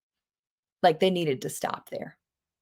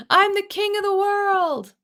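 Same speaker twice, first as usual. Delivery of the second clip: much higher, jubilant call; triumphant shout; 12 semitones higher, 3 dB louder than usual. Acted. Teacher quoting Titanic hero, jubilant. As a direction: cry it out from the ship's bow.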